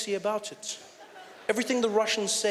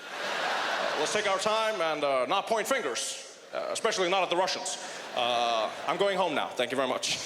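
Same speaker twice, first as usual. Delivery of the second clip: hesitant, backpedalling president, lightly voiced; a mild take on a nervous, hedging president; lightly voiced president backing down, full of uhs; Barack Obama impression; 3 semitones lower than usual. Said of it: "Obama was like".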